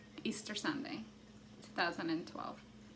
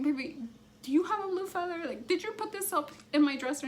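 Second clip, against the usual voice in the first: high, louder, tense asking; a frightened mom asking her daughter, pitched high and louder; panicky questioning; 10 semitones higher, 4 dB louder, faster than usual. Retold or imitated. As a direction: quiz the child urgently, voice climbing.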